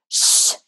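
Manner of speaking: emphatic shush. Shown as a demonstration. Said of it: "a nice emphatic shush".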